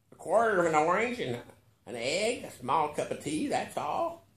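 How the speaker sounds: put-on old billionaire, unhurried and sure; lightly voiced old billionaire. A narrator's reading.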